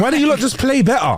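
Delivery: high-pitched mock heckle; exasperated sports fan. Acting a fan berating the team, exasperated. Mimicking fans heckling players.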